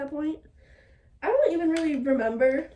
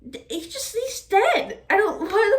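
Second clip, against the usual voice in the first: shocked disbelief; 8 semitones higher, 6 dB louder, slower than usual. Real, not acted. Shock at character's death.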